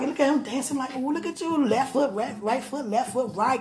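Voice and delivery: high-pitched re-enacted cheering; high, giddy replay of watching a sweetheart dance; excited cheering. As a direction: coo along excitedly with each dance step.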